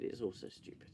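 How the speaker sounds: breathy exasperated whisper. Real, not acted. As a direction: whisper it in despair. Exasperated muttering.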